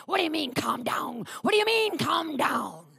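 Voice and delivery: mock indignant outburst, building; put-on combative challenge, rising in pitch; picking a fight. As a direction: imitate a husband flaring up. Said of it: "Started to fight with me".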